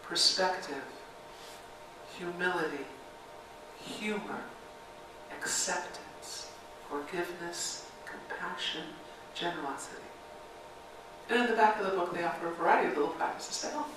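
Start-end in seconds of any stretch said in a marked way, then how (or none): 0.0-10.0 slow, soft, deliberate listing; unhurried, quiet roll call of virtues; read slowly. Shown as a demonstration. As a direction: let each word sit before the next. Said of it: "I'm gonna read them again slowly".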